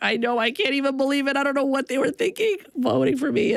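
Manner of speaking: high-pitched giddy amazement; excited disbelief. Retold or imitated. Daughter answering mom about the election, giddy disbelief. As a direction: act out marvelling at your own win.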